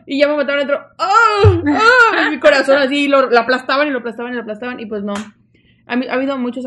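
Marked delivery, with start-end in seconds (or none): 1.0-2.3 mock anguished wail.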